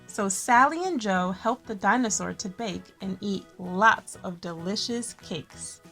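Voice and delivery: plain reading voice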